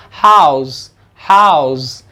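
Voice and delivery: teacher drilling the noun हाउस; house with s. Shown as a demonstration. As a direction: repeat हाउस with a clean hissing s. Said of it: "इसका pronunciation हाउस होगा".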